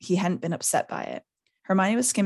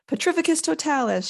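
crying out; 9 semitones higher than usual. A narrator's reading. Hermione points her wand at Neville, crying out. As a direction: call out the spell, pitch lifted. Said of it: "she cried".

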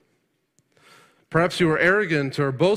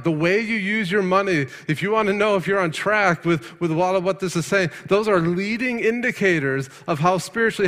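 fiery preaching; 6 semitones higher than usual. Acted. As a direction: urge the congregation hard. Preacher builds to full force.

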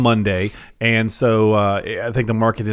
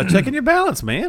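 high mock advisor voice; 12 semitones higher than usual. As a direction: voice jumped way up, teasing.

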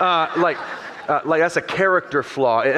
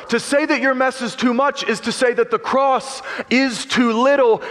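ordinary talk

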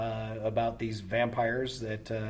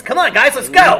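much higher and much louder tough little sailor; Popeye on helium; 17 dB louder than usual. Shown as a demonstration. Actor pitches the director a Krillin voice, high and loud. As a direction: bark it like a tiny tough guy on helium. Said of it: "something like this".

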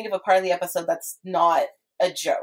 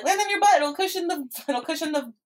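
quoting SpongeBob's advice, high; 8 semitones higher than usual. Retold or imitated.

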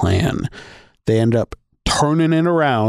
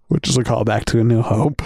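ordinary delivery

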